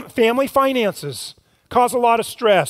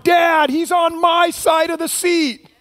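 higher, slow whiny tattle; shouted complaint of a little kid; whiny tattling little sibling; 7 semitones higher than usual. Retold or imitated. Kids complaining in back seat.

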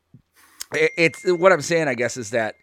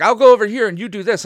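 mock heroic call; 9 semitones higher, 10 dB louder, faster than usual. Voicing a character splitting up.

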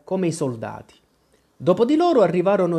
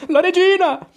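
even higher, louder panicked repeat; panicked shout; 7 dB louder than usual. Acted. Cinque repeats the warning, higher and more panicked. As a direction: cry it again in rising panic. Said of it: "esclamò".

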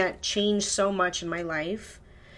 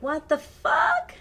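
high, drawn-out incredulous outburst; retold disbelief; 8 semitones higher, slower than usual. Storyteller reliving news of her dropped wallet, incredulous. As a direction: stretch it out, pitch leaping up in disbelief.

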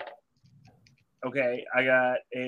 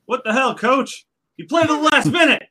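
high, loud mock protest at the coach; indignant outburst; 9 semitones higher, 6 dB louder than usual. Player protesting to the coach after the game, indignant. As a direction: yell it like an outraged player.

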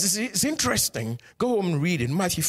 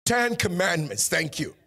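ordinary talk, nothing marked

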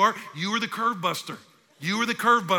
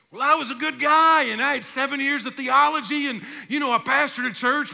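high, loud mock self-defence; pleading one's case; 9 semitones higher, 7 dB louder than usual. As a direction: plead your good record before the judge. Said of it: "start to plead your case".

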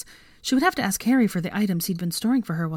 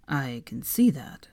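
dry whisper; 5 semitones lower, slower than usual. A narrator's reading. Draco dry, whispered aside.

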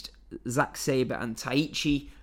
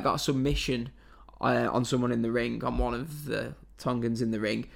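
ordinary delivery

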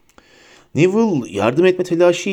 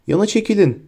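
raised-pitch, harsh order; harsh command; 7 semitones higher than usual. A narrator's reading. Death Eater ordering others aside, harsh. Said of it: "dedi haşin bir ses".

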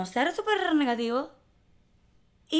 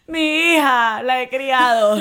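louder and higher, breaking into laughter; laughing mock reply; 4 semitones higher, 11 dB louder, much slower than usual. Telling her co-host how she answered her daughter, laughing. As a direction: play it up as a proud, amused mother.

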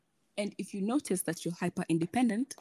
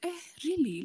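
incredulous; 10 semitones higher, 5 dB quieter than usual. Retold or imitated. Her own retold reaction.

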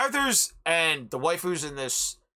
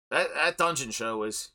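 usual speaking voice

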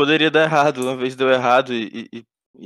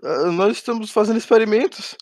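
higher, shaky captive stammering; nervous captive voice; nervous cultist; 8 semitones higher, 5 dB louder than usual. Acted.

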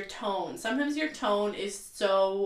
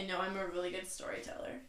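whispered self-mocking aside; a whisper to herself, mocking her own storytelling; joking whisper; 10 dB quieter, faster than usual. Real, not acted. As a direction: whisper it to yourself. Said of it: "joking whisper".

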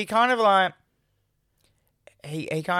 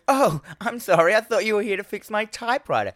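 apologetic receptionist; 10 semitones higher than usual. Retold or imitated. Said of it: "she goes".

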